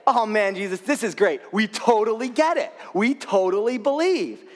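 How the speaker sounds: enthusiastic disciples. Acted. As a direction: play the disciples totally sold on it. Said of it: "very excitedly, very enthusiastically".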